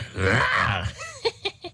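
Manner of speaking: lautes, fremdartiges Hohngelächter; böses Dämonenlachen. Acted. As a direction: lach wie ein großer Dämon. Dämon lacht furchteinflößend. (furchteinflößendes Dämonenlachen)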